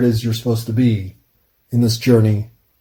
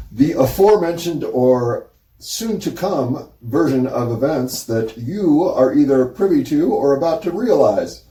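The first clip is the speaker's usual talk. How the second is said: ordinary talk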